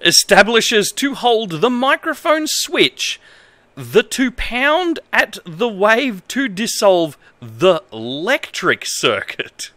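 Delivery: reads broken manual text in a lightly formal voice; straight-faced reading of nonsense instructions; mock-earnest manual reading. Reads bad manual translation aloud.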